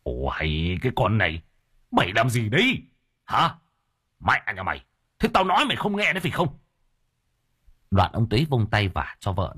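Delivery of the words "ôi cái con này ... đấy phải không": angry interrogation rising in pitch; menacing husband. Acted. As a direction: confront your wife aggressively. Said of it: "xông đến hàm hàm túm cổ vợ".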